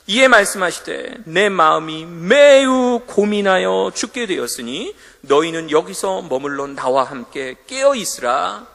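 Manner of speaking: loud, slow solemn scripture read. Acted. 설교자가 예수님의 겟세마네 말씀을 천천히 크게 낭독. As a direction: read Jesus' anguish slowly and loud. Reading Jesus' words in Gethsemane.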